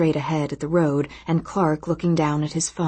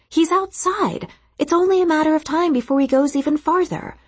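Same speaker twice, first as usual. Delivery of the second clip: Lisa's voice pitched up; defensive, higher voice; 11 semitones higher than usual. A narrator's reading. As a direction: defend the point eagerly. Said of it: "she defended".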